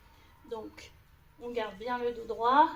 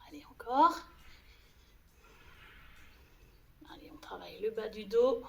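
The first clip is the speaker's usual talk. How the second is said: breathy effort whisper; faster than usual. Real, not acted. Coach exercising during the rows.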